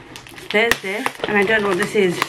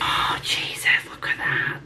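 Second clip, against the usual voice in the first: awed whisper; 6 dB quieter than usual. Real, not acted. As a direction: whisper it in awe. Admiring the edition, breathless.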